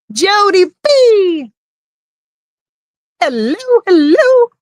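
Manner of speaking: high, stretched-out excited hello; slow, high-pitched hailing call; drawn-out greeting. Real, not acted. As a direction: sing out a long, high greeting to a friend joining. Calling out greeting to arriving viewer.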